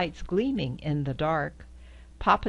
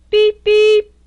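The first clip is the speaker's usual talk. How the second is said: much higher, loud tinny beeps; high squeaky beep; 16 dB louder than usual. Acted. Reading to a child, beeping high and loud like a horn.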